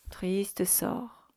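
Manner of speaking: soupir chuchoté. A narrator's reading. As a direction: murmurer sans voix. Réplique chuchotée. (triste sort chuchoté)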